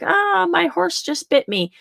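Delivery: high, slow whiny complaint; mock upset outburst. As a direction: play the upset owner, hammed up. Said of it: "getting all upset and like".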